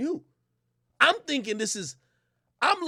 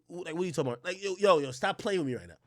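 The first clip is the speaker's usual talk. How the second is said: normal talking voice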